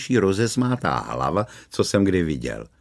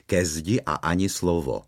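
usual speaking voice

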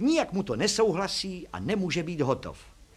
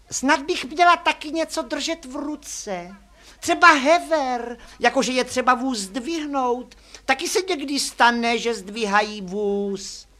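put-on high, loud, slow girl's voice; girl character; 9 semitones higher, 6 dB louder, slower than usual. A narrator's reading. Girl suggests holding a jack for the photo, high character voice. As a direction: pitch it well up and draw it out.

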